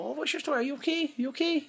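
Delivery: high, concerned imitation; mock-caring high voice; caring Donna. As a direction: sweetly check on a stranger. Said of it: "kind of like".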